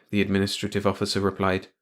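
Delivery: usual speaking voice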